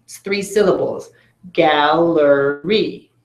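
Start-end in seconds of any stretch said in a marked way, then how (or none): 1.5-3.1 "Gallery" drawn out slowly, each syllable clear; slow demo word. Shown as a demonstration. Teacher modelling the word for the class, slow and clear. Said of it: "watch what I would do".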